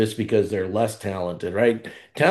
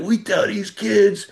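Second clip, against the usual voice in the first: put-on shouting it out in a New York accent; 11 semitones higher than usual. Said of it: "Bobby Cremins got that New York accent".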